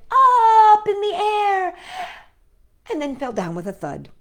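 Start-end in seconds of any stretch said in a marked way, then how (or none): 0.1-1.7 high, slow excited lift; hopeful rising. A narrator's reading. Narrator building hope as the kite rises, high and slow.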